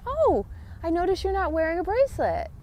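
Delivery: high, bright remark to a friend; cheerful noticing. Retold or imitated. Telling the cashier she forgot her bracelet, bright and high. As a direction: point it out with a lifted, sunny voice. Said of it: "I said".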